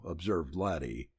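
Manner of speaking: usual speaking voice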